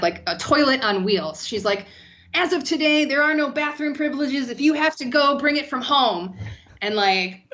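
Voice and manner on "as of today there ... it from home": pitched-up mock decree; mock-stern announcement in a higher voice; mocking the boss. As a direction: parody an officious boss's announcement. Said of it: "She's, like, mocking Tony".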